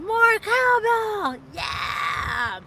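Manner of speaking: high, loud, drawn-out call; mock shout. Quoting the cowbell sketch to chat, loud and high. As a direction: belt it out like the famous skit.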